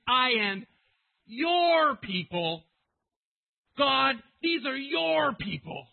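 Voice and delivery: "your" stretched high and very loud; insistent. Acted. Preacher as Moses pressing God about the people, insistent and loud. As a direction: shout it, leaning hard on "your".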